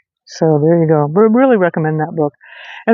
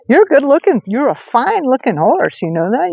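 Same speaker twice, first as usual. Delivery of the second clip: pet talk to horse; 7 semitones higher than usual. Real, not acted. Complimenting the horse.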